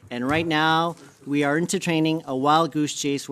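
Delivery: ordinary delivery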